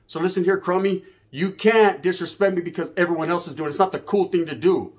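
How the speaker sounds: ordinary talk, nothing marked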